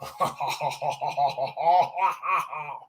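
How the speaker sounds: long, high mocking laugh; gloating pirate laugh; captain's laugh. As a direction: laugh it off at him. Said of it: "he laughed".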